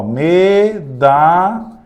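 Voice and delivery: medalha drawn out slowly; sounded-out medalha. Shown as a demonstration. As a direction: stretch medalha out. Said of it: "tá bem tranquila". (medalha bem devagar)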